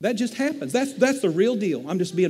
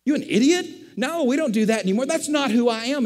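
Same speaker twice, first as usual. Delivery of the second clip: indignant self-rebuke; 5 semitones higher than usual. Retold or imitated. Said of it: "I'm like".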